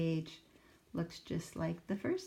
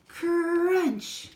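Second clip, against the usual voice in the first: loud, much higher crunching noise; 12 semitones higher, 10 dB louder than usual. Acted. Sammy eats the classroom furniture with a loud crunch. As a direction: chomp it out loud.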